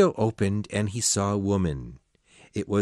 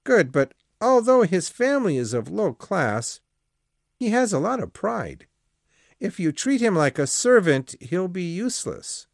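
a lightly voiced priest, pitched up in warning; 10 semitones higher than usual. Priest warning the Marquis about Julien's pride, mildly stern. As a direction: caution him, old priest's voice. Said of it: "the priest warned".

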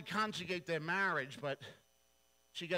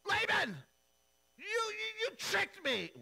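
very high and loud outraged wail; cheated groom shrieks at father-in-law; furious whine; 6 dB louder than usual. Retold or imitated. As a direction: screech at him for the trick. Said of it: "he flips out".

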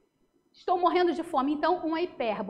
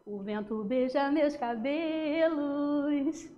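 poem recital; much slower than usual. Shown as a demonstration. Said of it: "Ó o exemplo".

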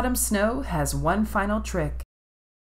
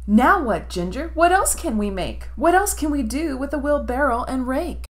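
put-on higher, eager little boy; 8 semitones higher than usual. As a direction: ask for the next game. Tommy asks eagerly.

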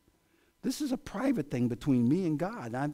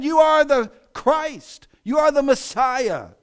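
much higher, louder, slow declaration; triumphant proclamation; 10 semitones higher, 10 dB louder, slower than usual. Acted. Peter declares to Jesus in a loud, slow proclamation. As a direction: proclaim it to the whole church.

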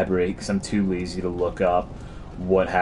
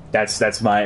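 usual speaking voice

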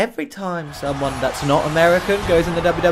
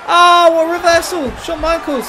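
high, loud burst of excitement; excited reaction; 12 semitones higher, 4 dB louder than usual. Real, not acted. Player cheers a reversal in his wrestling game, excited. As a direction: call the move with the voice leaping up.

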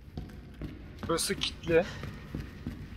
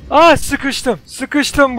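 higher, louder cry of being trapped; distressed cry; 5 semitones higher, 5 dB louder, much slower than usual. Real, not acted. Player cornered by a zombie, distressed cry.

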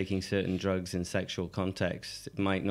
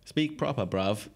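put-on quick jab in London street accent; 3 semitones higher than usual.

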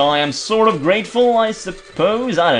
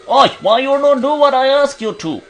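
gruff character voice; 8 semitones higher than usual. Acted. Reading game character dialogue aloud.

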